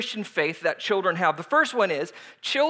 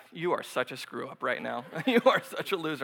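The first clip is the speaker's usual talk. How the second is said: judging mutter; 7 semitones lower, 7 dB quieter than usual. Retold or imitated. Onlookers judging the pastor.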